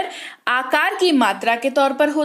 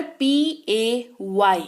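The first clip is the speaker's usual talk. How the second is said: 1 slow spelling; much slower than usual. Shown as a demonstration. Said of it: "ये इसको कहते हैं पे".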